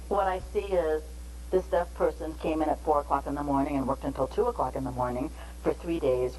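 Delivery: usual speaking voice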